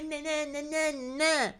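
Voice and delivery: high mocking "na na na"; mocking giggly singsong. Commentator mimicking someone kiki-ing on camera, high mocking chatter. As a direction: tease with high-pitched mock giggly nonsense. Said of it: "kiki and laugh".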